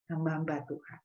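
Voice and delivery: usual speaking voice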